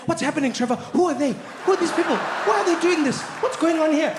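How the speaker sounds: a hint of a confused mother firing questions; confused mom. Mom confused by the movie, mildly mimicked. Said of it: "the whole movie she'd be like".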